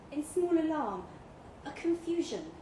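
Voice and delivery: ordinary delivery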